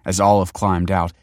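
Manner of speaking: plain reading voice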